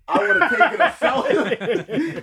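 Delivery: high, slow, laughing through it. Real, not acted. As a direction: crack up while saying it. Joking about cousin's caught act.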